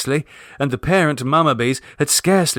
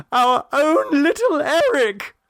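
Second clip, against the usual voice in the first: high, slow joyful shriek of a mother; screamed joyfully; slower than usual. A narrator's reading. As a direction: shriek it out with delighted relief. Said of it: "screamed Missus Mummaby".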